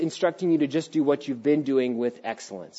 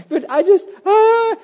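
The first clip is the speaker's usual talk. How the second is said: higher, breaking mock sob; imitating crying daughter; 6 dB louder than usual. Daughter crying to her father, imitated sob. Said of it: "And she's crying".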